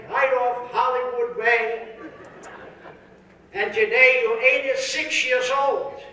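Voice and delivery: put-on slow, high, deliberate old-man recital. Old friend reciting the facts slowly, high old voice. Friend reads his whole life.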